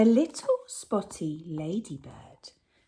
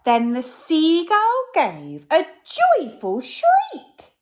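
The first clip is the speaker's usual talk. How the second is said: joyful shriek; 9 semitones higher, 8 dB louder than usual. A narrator's reading. Narrating the seagull's shriek.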